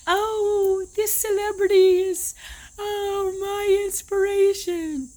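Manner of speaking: high, breathless starstruck gush, hammed up; mock gushing fan. Mocking fans who idolize celebrities, starstruck gushing. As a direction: mimic a fan swooning over a celebrity.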